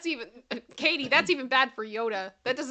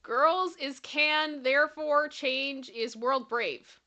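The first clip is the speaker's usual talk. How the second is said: slow reading; 2 semitones higher, slower than usual. Real, not acted. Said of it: "reading out garbled sign".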